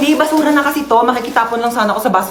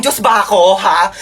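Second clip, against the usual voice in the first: usual speaking voice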